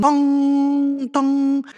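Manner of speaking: high, loud sung bong of a clock; clock chime. Pretend. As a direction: ring it out like a grandfather clock. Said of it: "make that dong sound?".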